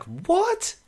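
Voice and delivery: high-pitched disbelieving question; incredulous. Retold or imitated. Viewer reacting to the absurd plot turn, incredulous. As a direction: shoot the pitch right up in bafflement.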